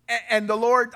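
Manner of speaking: ordinary talk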